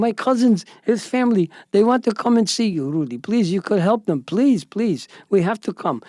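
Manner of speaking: excited Ari. Retold or imitated. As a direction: beg for help, imitating a worked-up caller. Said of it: "He's very excited".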